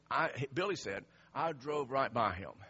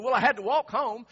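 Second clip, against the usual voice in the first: imitating a loud, high-pitched grievance; mad coworker; 9 semitones higher, 13 dB louder than usual. Stranded coworker to the man who passed him, loudly aggrieved. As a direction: complain loudly, voice pushed up. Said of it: "it really made him mad".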